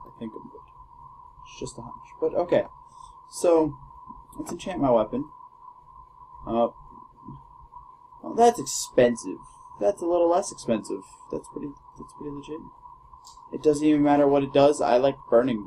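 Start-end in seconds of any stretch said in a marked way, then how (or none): 0.1-1.9 repeated in a hushed whisper. Real, not acted. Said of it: "repeats in whisper". 11.3-12.6 quick hushed aside; fast whisper. Real, not acted.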